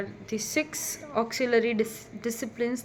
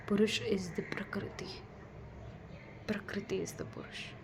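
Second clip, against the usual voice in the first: whispering; 7 dB quieter than usual. Pretend. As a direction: breathe it like a secret. Mystical point whispered for effect.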